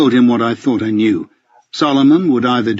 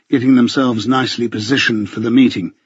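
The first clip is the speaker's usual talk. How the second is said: ordinary delivery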